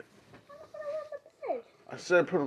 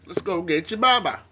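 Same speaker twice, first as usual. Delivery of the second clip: ordinary talk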